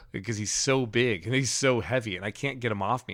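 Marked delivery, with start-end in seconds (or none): none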